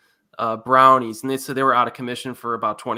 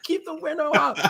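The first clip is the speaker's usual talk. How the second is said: high, laughing order; mock urgent yell. Stoner friends ordering him to keep window shut, high laughing yell. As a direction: bark it high, half laughing. Friends in car smoking weed.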